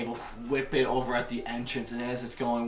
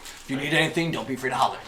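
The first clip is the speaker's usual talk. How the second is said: lightly voiced, louder tavern server; bartender; 3 semitones higher, 8 dB louder, much faster than usual. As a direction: call it out to the customers. Bartender calling out to customers.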